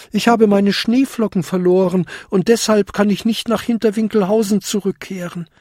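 high, hurried pleading; distressed little kobold. A narrator's reading. Kobold who lost his snowflakes, distressed. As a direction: explain the trouble in a high, small voice.

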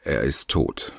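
halting, holding back tears; struggling to say it. A narrator's reading. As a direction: break off, pause, then force the words out. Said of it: "Es fiel ihr schwer, die Worte auszusprechen". (stockend, den Tränen nahe)

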